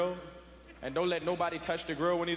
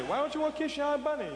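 mother scolding; 9 semitones higher than usual. Retold or imitated. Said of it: "Your mother say".

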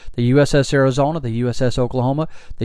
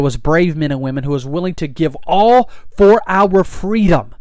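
rally the crowd with fiery conviction; impassioned preacher building to a shout; preaching at full force; 3 semitones higher, 4 dB louder than usual. Real, not acted. Fiery patriotic sermon climax.

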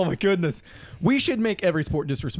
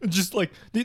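ordinary talk

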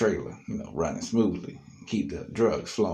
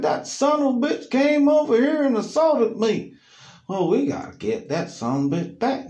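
high, loud aggrieved voice; mock angry complaint; 9 dB louder than usual. Alan telling Tim he was assaulted, high angry voice. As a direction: vent it and call for payback.

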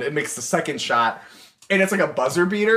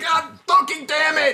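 frantic coach; 12 semitones higher than usual. Retold or imitated. Coach swearing in meltdown.

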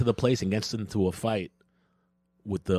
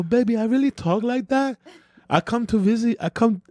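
put-on high girlfriend voice; girlfriend impression; 13 semitones higher than usual. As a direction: do her, pitched way up. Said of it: "the impression that I do of you".

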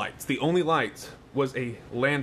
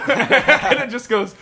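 loud and slow, words breaking into laughter; laughing; 11 semitones higher, 12 dB louder, slower than usual. Real, not acted. Teller cracking up retelling grandma's outburst, laughing loudly. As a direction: laugh through the telling. Laughing while telling the story.

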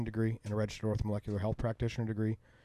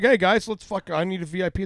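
put-on high, loud party-boy call; high, loud retold demand for VIP; mock loud demand; 9 semitones higher, 8 dB louder than usual. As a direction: bark it like a self-important big shot. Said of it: "I'm like, I'm like".

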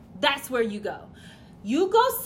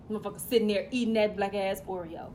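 ordinary talk, nothing marked

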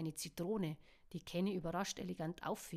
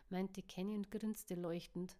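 ordinary delivery, nothing marked

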